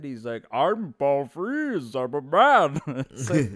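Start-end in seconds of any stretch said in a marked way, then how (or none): 0.5-2.8 very slow, exaggerated accented actor voice; Paul Frees, goofy accent. Voice actor introducing himself, comically over-accented. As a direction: draw it out in a hammy, goofy accent. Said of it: "natural speaking voice is so accented".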